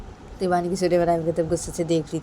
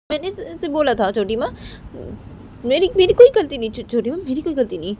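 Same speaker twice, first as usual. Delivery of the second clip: higher-pitched, stammering self-defence; pleading daughter-in-law; 6 semitones higher than usual. Acted. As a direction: stumble through anxious excuses to an elder. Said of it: "Raima वो बानी की छोटी माँ को बोलती है". (ऊँची आवाज़ में अटकती सफ़ाई)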